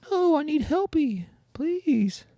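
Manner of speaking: very high, pleading horse voice; whinny a fake plea for help; high-pitched horse pretending to be drowning; part-horse kelpie. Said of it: "you sound kinda like a part, part horse".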